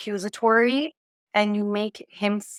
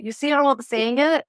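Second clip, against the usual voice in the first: ordinary talk, nothing marked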